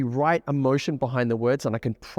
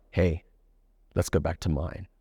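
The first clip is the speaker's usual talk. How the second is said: seductive; 8 semitones lower, 3 dB quieter than usual. Retold or imitated. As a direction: purr it low, dripping with sexual intent. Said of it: "being very sexual in how he delivers his speech".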